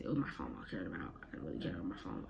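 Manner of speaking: dropped to a hush, fast; barely voiced, rushing the words; admitting she cared about the phone, hushed; whispered aside. Real, not acted. Trailing quiet confession.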